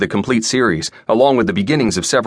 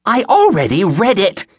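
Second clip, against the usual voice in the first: very high-pitched indignant wail; incensed old woman; 13 semitones higher, 4 dB louder than usual. A narrator's reading. Old customer rejecting clerk's answer, incensed. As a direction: insist at the top of your range. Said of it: "the incensed old woman said".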